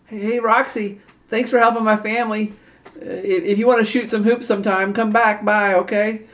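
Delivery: put-on very high little-boy voice; young boy. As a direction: pipe up high as a kid inviting a friend over. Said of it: "the son, the boy".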